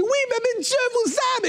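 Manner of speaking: crié très haut et fort, rapide; lance-le en criant par-dessus la musique. Retold or imitated. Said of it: "moi, je crie, je crie". (crié très aigu au couple pour couvrir la musique)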